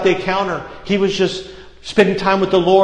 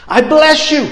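fervent blessing; 11 semitones higher, 6 dB louder than usual. Acted. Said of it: "grabbed a hold of him and said".

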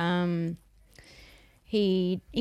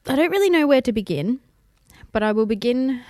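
louder, mock-dramatic announcement; grand opening; 4 semitones higher, 8 dB louder than usual. Beginning her story to listeners, mock-grand and louder. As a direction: launch it like a big speech.

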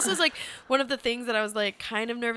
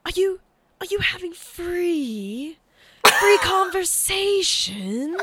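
high, slow, drawn-out creepy impersonation; creepy old man; 9 semitones higher, 7 dB louder, slower than usual. Retold or imitated. Woman imitating the shabby man who sauntered over, creepy voice. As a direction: imitate an oddball stranger, stretching each word out. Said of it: "he kind of had a way of talking".

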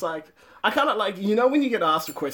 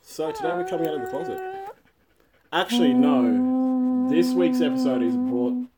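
announcer voice; 9 semitones higher than usual. Acted. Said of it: "Whoa, we just bonded".

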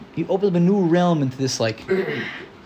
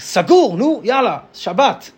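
brusque Israeli shopkeeper; 7 semitones higher, 3 dB louder than usual. Retold or imitated. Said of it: "It's like".

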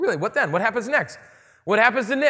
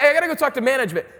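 rushed mall cop; 6 semitones higher, 5 dB louder, much faster than usual. Retold or imitated. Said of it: "they're like".

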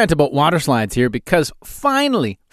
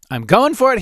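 pumped, pitch jumping higher; psyched up; 7 semitones higher than usual. Retold or imitated. At the gas pump, psyching himself up, excited. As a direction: hype yourself up to go for it. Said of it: "and I thought".

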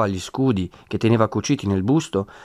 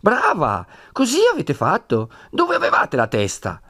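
scornful high «Brava!»; mock praise, scolding; sarcastic Brava; 8 semitones higher than usual. A narrator's reading. Don Abbondio scolds. («Brava!» detto con sarcasmo, acuto)

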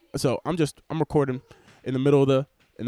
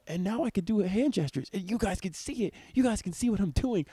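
higher, eager voice to the viewers; thrilled they can finally see his hands; excited; 7 semitones higher than usual. Real, not acted. To his viewers about the camera, excited and high.

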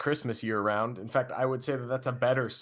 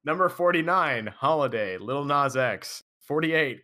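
big announcer voice, numbers punched loud; countdown announcer; 2 semitones higher, 4 dB louder than usual. Real, not acted. Host reading out the Christmas song ranking, punchy countdown announcer. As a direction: launch the countdown like a show host.

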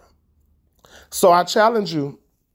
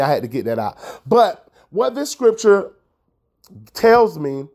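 ordinary talk, nothing marked

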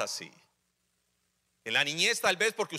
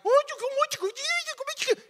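squeaky high-pitched buddy voice, hammed up; mock youthful greeting; faster than usual. Preacher mocking a dad greeting his grown son in a squeaky voice. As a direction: jump way up high like a pal greeting a teen. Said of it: "no puedo llegar".

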